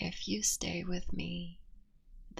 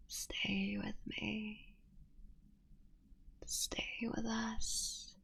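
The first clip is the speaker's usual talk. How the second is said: tender plea; 4 semitones higher, 5 dB quieter, slower than usual. Acted. Begging partner to stay.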